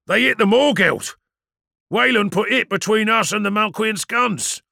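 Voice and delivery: loud, high battle voice; excited report. Acted. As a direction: call out the news in amazement. Crewman reports hit on other ship.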